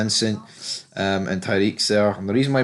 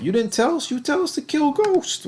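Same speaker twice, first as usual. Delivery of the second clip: much higher put-on protesting voice; mock protest. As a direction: squeak it back in defence. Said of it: "It's like".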